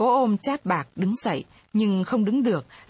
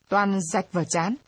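ordinary delivery

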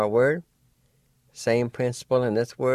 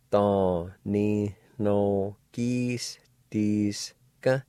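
To ni no kis dis ka very slow and drawn out; slow demo; much slower than usual. Shown as a demonstration. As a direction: spell To ni no kis dis ka out slowly for learners. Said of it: "pronounced like this".

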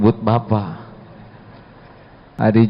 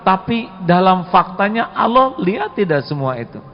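fervent preaching; 8 semitones higher than usual. Acted. Preacher demands the congregation answer, fervent and high. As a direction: drive the question home to the crowd.

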